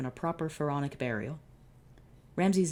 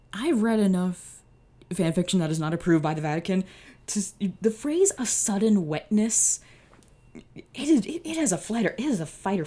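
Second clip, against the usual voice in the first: high, loud, agitated ranting; worked-up rant; 6 semitones higher, 8 dB louder than usual. Real, not acted. Reader ranting to listeners about fanfiction, high and loud. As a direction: spiral into comic panic.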